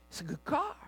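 ordinary talk, nothing marked

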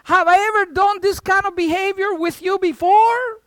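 high, loud donkey voice asking; donkey protesting to Balaam; pleading donkey. Acted. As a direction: appeal to the master hurt and loud. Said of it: "The donkey answers and says".

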